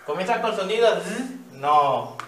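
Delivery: ordinary talk, nothing marked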